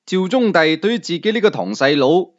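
plain reading voice

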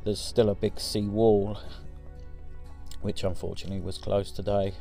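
normal talking voice

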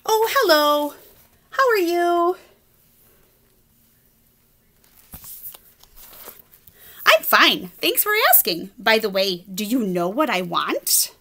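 chatty pigeon. Acted. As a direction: chirp it like a cheeky pigeon. Reader voices the pigeon.

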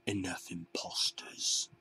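slow, menacing whisper. A narrator's reading. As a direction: breathe it like a threat. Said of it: "B one said".

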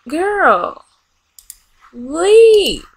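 a high, loud fed-up command; exasperated order. Real, not acted. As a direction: throw her out, fed up. Ordering sim out.

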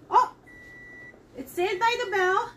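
usual speaking voice

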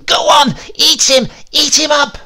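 high, loud shout; old woman setting her dog on the man, shouting; crying out. Acted. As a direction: yell the attack command at full force. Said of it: "she cried".

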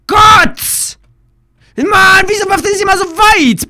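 high, strained complaint; real frustration; exasperated cry. Real, not acted. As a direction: plead with the game in despair. Exasperated at the game.

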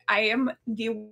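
ordinary talk

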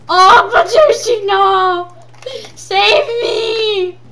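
loud, high hammed-up cry for help; playful panicked yell; mock panic scream. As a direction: scream for rescue, half playing it up. Zombies attack, screaming.

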